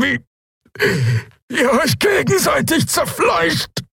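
rising from slow strain to a high, broken scream; each syllable a fight, climbing to a shriek; dying prisoner spitting contempt at his captors; tormented by every syllable. A narrator's reading. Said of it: "von jeder Silbe gequält".

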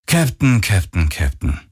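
sighed repetition, falling in pitch; sighing. A narrator's reading. Voice on the comm doubting the captain, sighing. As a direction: chide with a weary sigh, stepping downward. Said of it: "ertönte es seufzend aus ihrem Komm".

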